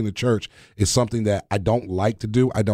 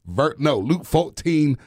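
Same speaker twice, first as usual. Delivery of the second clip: slow, higher southern preacher voice; hammed-up southern preacher; southern accent; 5 semitones higher, slower than usual. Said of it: "How's my southern accent?".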